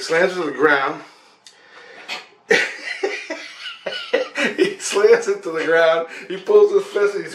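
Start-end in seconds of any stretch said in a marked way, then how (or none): 2.5-4.5 long high laugh; laughing fit. Real, not acted. Storyteller reliving the demon slam, long high laughing fit. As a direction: crack up helplessly mid-story.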